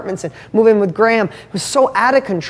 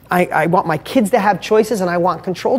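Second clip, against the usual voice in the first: ordinary talk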